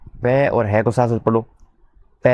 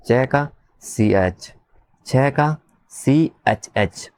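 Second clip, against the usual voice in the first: slow dictation; slower than usual. Shown as a demonstration. Teacher spelling letters out for copying.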